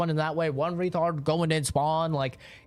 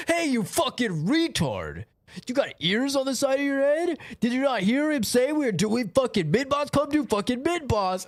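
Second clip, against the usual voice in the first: Oscar raging; 9 semitones higher than usual. Retold or imitated. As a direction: rant like a toxic teammate. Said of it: "fucking Oscar would be like".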